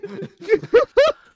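squealing laughter; wheezing laugh burst; helpless high laugh. Real, not acted. Keeps laughing.

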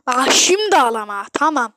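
loud yelled demand; angry yell at the game; shouted warning. Real, not acted. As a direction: bellow it at him. Shouting at teammate over trophy.

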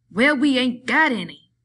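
sudden angry outburst, loud; exploding in anger. A narrator's reading. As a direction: snap it out, fed up. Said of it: "George exploded".

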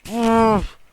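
sharp, high, loud cry of annoyance; frustrated outburst. Real, not acted. Gamer yelps in frustration at an enemy, loud and high. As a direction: let out a fed-up yelp at the screen.

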